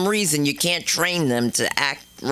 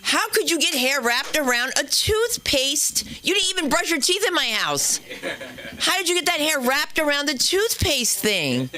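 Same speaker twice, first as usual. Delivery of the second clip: higher, incredulous complaint to a houseguest; exasperated host; 10 semitones higher than usual. Retold or imitated. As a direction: complain in mock disbelief. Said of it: "and you're like".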